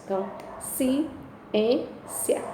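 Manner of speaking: "ciência" drawn out very slowly to show its syllables; slow syllable demo. Shown as a demonstration. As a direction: slowly pull "ciência" apart for the students. ("ciência" bem devagar, mostrando as sílabas)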